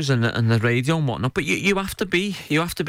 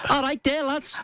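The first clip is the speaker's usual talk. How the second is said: high, put-on chirpy phone voice, much higher; mock phone greeting; 11 semitones higher than usual. Teasing a co-host by mimicking his cheery phone greeting. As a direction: send up a mate picking up a call. Mocking a teammate answering the phone.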